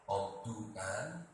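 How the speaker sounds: normal talking voice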